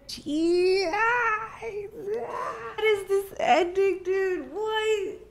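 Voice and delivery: very high, drawn-out tearful whine; emotional wail. Real, not acted. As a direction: wail softly in disbelief, close to tears. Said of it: "I'm getting emotional watching this".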